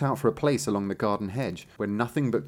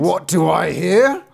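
high, loud, drawn-out outrage; outraged cry; 8 semitones higher, 10 dB louder, slower than usual. Acted. Tailor hears the goat's complaint, loud outraged cry. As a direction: cry out slowly in scandalised disbelief. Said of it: "cried the tailor".

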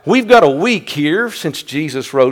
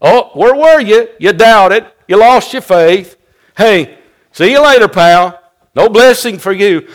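loud, higher dismissive rebuke; mock scolding; 6 semitones higher, 7 dB louder than usual. Preacher acting a rebuke Jesus never gave, mock scolding. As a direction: brush off the backslider with mock indignation.

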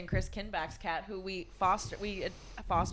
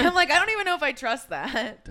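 laughing; 7 semitones higher, 4 dB louder than usual. Retold or imitated. Laughing retelling of suspicious shopper.

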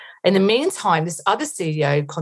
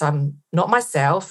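ordinary talk

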